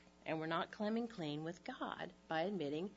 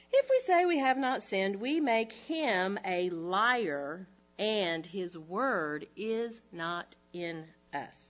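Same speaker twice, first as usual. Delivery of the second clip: slow, weighty reading of the verse; slow scripture reading; 7 dB louder, slower than usual. Acted. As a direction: read the verse slowly and solemnly.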